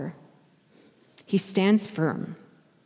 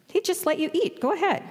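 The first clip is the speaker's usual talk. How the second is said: coaxing devil, pitched up high; tempter's voice; 8 semitones higher, faster than usual. Acted. The devil urging hungry Jesus to eat, coaxing and high. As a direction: sweet-talk him like a tempter.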